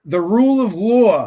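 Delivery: loud, high-pitched New York accent imitation; hammed-up New York "law", big and loud. Co-host asks him to do Cohen's accent, exaggerated New York imitation. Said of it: "can you do it?".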